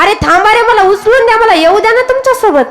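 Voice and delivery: high-pitched pleading call. Acted. Left-behind thief calls to his departing companions, pleading call. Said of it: "तो आवाज देऊ लागला".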